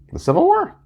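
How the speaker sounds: incredulous. Real, not acted. Doubting his own guess.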